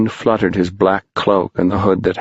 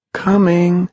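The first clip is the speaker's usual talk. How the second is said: distant cry; 11 semitones higher than usual. Acted. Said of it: "was the cry".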